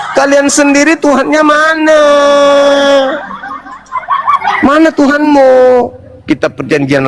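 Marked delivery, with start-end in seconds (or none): 0.1-5.5 high taunting shout; challenging shout. Real, not acted.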